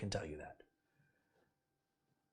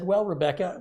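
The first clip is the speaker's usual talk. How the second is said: "Well, Rebecca" pitched higher and louder; indignant retort; 6 semitones higher, 7 dB louder than usual. Retold or imitated. To the FedEx agent, a raised, indignant "Well, Rebecca". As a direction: call her out, indignant.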